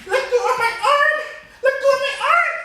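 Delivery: put-on high, loud girl's yelp with a laugh in it; little girl crying out. Girl fights off missus.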